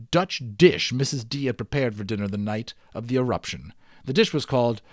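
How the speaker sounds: lively read-aloud storyteller; story narration. Acted. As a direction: tell the adventure with growing drama. Reads the adventure story aloud.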